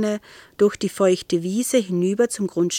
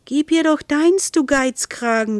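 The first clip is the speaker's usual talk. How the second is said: indignant retort; 6 semitones higher, 3 dB louder than usual. A narrator's reading. Cat's indignant thought at stingy man.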